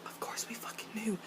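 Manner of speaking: breathy whisper, dropped right down; whispered aside. Real, not acted. Sneaking a sarcastic aside to viewers in a whisper. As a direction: confide it under your breath.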